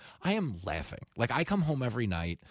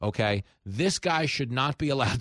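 ordinary delivery

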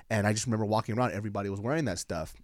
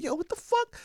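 surprised. Retold or imitated. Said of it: "I'm like".

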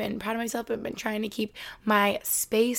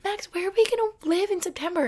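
high, fretful put-on voice of a worrier; anxious self-mimic; 9 semitones higher than usual. Mimicking herself pestering Max, higher and fretful. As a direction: pitch it up like a nervous planner. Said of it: "I'm like".

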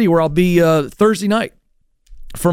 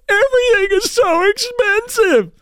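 very high, drawn-out mock complaint; mocking snowflake. Snowflakes griping about prices, mocking high complaint. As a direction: moan it slowly in a silly falsetto. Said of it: "And then they go".

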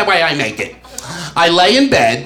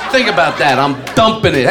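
ordinary talk, nothing marked

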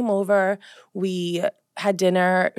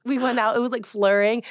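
ordinary talk, nothing marked

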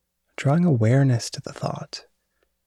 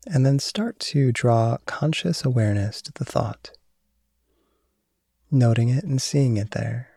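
guided meditation. Acted. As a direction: calmly guide awareness of thought. Leads a mindfulness meditation.

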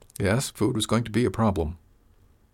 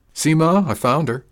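put-on higher, louder boasting from a small boy; proud triumph; 7 semitones higher, 6 dB louder than usual. A narrator's reading. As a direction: crow it proudly to his mother. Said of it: "Riley's voice carried proud triumph".

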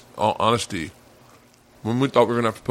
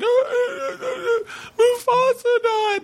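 very high, hammed-up sobbing wail; mock crying; much slower than usual. Wife phones husband that the cat died, exaggerated bawling. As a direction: wail the news through exaggerated, drawn-out sobs. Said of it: "Leanne's fucking crying aggressively".

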